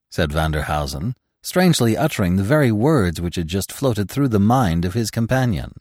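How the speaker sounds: ordinary delivery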